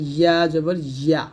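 slow ইয়া জবর ইয়া, a full palatal y; tongue-to-palate ya. Shown as a demonstration. Teacher showing where ya is formed, palatal glide. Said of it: "জিহ্বার মধ্যখান তার বরাবর উপরের তালুর সঙ্গে লাগিয়ে".

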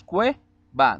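"cue, va" split in two beats; syllable by syllable. Shown as a demonstration. Teacher splitting "cue, va" for the pupils. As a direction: pause between "cue" and "va". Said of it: "en sílabas tenemos".

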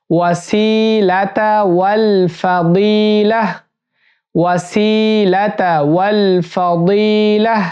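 ওয়াসিলাতা with a clear সিন; সিন স্পষ্ট করে তিলাওয়াত; correct recitation. Shown as a demonstration. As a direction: model ওয়াসিলাতা with a crisp s sound. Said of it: "এখানে সিন রয়েছে".

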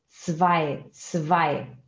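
"Zwei" with a sharp ts start and ai vowel; ts sound. Shown as a demonstration. Teacher models zwei for Portuguese learners. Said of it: "som de T S".

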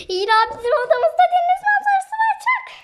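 excited squeal. Real, not acted. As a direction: squeal with delight about the view. Excited about sea view. (heyecanlı çığlık)

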